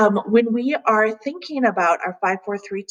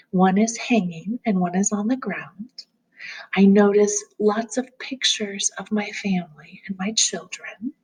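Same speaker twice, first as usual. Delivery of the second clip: calm grounding exercise. Acted. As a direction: gently guide them through noticing.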